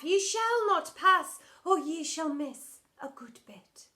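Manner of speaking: loud, high, slow theatrical decree; hammed-up theatrical teacher blocking the door; grand stage command. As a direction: bar the way like a wizard on stage. Teacher blocks the toilet path.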